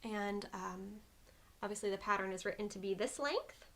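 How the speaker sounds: ordinary delivery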